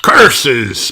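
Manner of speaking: loud pirate curse; bellowing pirate. Acted. Redbeard jumps off his sinking ship, bellowing. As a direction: bellow it like a cross old pirate. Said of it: "bellows Redbeard".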